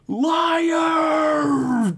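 high, furious crying shout; demonic bellow. Acted. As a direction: bellow it at him in rage. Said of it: "T K bellowed out, her voice overlaid with a deep demonic reverb".

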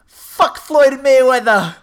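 high, loud, drawn-out holler; acted rowdy-fan shout. Imitating Irish fans taunting his friend, loud high jeer. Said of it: "And they look and say".

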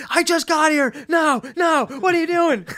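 high mock Kinison yelling; Sam Kinison. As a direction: shriek it like Kinison in pain. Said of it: "him just being like".

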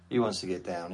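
ordinary talk, nothing marked

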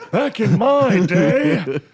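put-on slow, high old man voice. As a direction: croak it like a grumpy grandpa. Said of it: "[name], old man voice".

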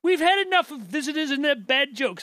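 high-pitched mock English accent; indignant quote in a fake English accent. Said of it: "they got an English accent".

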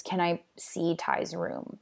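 a breathy, unvoiced whisper, half-imitated; retold whisper. Will quietly asks Eden to see the room, whispered. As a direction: hush it like sneaking into a grieving home.

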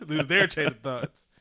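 loud, laughing through the words. Real, not acted. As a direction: talk while cracking up. Laughing at co-host.

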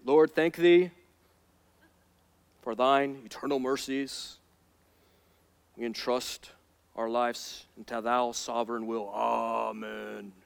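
lightly mocked pious, archaic prayer voice; old English prayer. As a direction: pray in solemn thee-and-thine tones. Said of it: "They begin to speak in o-, Old English".

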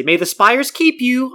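slow, high, reverent farewell; hammed-up solemn blessing; solemn greeting. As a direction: intone it like a park cast member blessing guests. Mock theme-park farewell greeting.

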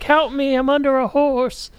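high, slow put-on plea; mock helpless cry for help; high pitiful stranger. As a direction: whimper it like a trapped damsel. Trapped stranger calling for help.

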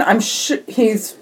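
usual speaking voice

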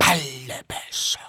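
slow, forceful stage whisper; drawn-out whisper. Real, not acted. Guest dodging the host's price question, slow whisper. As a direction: whisper it slowly, like a secret. Repeats price confidentially when asked again.

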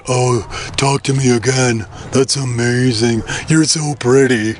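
slow, dopey lovestruck man; mock-smitten, melting into putty; besotted Samson. Voices besotted Samson in her hands.

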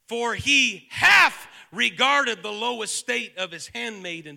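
loud, slow scripture declamation, punching each "hath"; intense, fervent reading. Shown as a demonstration. Preacher reading Mary's song aloud to congregation, intense and fervent. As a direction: proclaim the verses with growing force, drawing out key words. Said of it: "say it with the same intensity and in fervor".

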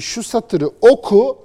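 loud, high and slow, daring them to read it; challenging command. Pretend. Commentator daring critics to recite a line, loud and slow. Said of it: "de ki".

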